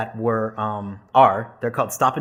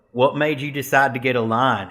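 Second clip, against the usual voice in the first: deep Southern drawl; 4 dB louder than usual. Retold or imitated. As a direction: ask it in a broad Southern drawl. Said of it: "really deep Southern drawl".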